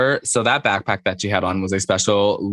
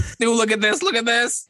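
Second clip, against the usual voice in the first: squeaky high imitation; high mock excited voice; 13 semitones higher than usual. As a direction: gush it like a giddy gossip. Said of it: "She's like".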